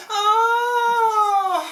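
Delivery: high loud ecstatic oh; high moan. Acted. Lovers gemissent, high loud ecstatic moan. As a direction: cry out in mock pleasure. Moaning climax.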